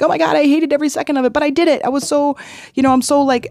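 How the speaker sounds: high, fast, breathless rush of relief; excited gush. Retold or imitated. Kid tells grandparents about the slide, excited and fast. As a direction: blurt it all out, thrilled and rattled.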